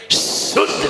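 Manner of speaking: शुद्ध opened with a long whistling sibilant. Shown as a demonstration. Teacher showing how to say it, शुद्ध with a whistled sibilant. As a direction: hold the hissing first sound of शुद्ध before the vowel. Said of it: "सीटी आ रही है?".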